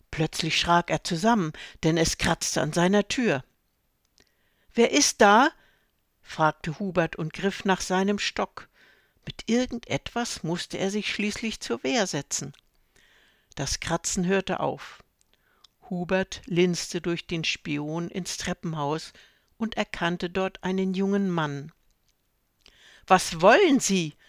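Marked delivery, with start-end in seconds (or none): 4.7-5.5 high, loud alarmed call through door; startled call. A narrator's reading. Startled old man at door. 23.1-24.1 loud wary call through door. A narrator's reading. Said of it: "rief Hubert".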